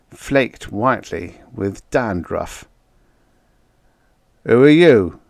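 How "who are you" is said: cold, loud challenge. A narrator's reading. Henderson questioning the captured stranger, coldly. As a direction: demand it coldly, voice raised. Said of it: "Henderson asked coldly".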